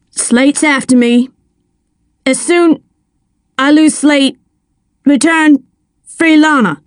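thin, broken voice over a bad line; words cutting in and out on a phone; scratchy phone line. A narrator's reading. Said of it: "came through scratchy and thin".

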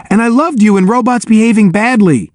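high, starstruck praise; admiring. A narrator's reading. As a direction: gush like a fan. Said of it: "the other soldier added admiringly".

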